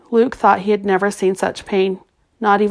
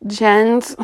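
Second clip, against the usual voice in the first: voice breaking; 3 semitones higher than usual. A narrator's reading. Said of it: "his voice broke".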